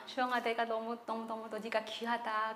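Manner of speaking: soft, low, comforting voice; tender reassurance. Retold or imitated. Jesus to a hurt girl in a dream, tender. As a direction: speak gently, as if holding someone close.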